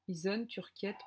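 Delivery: ordinary delivery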